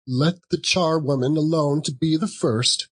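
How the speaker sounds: high, lightly voiced charwoman crying out; cried out. A narrator's reading. Charwoman announcing herself to old Joe, high and cackling. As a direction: call it out like a pleased old charwoman. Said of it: "cried she who had entered first".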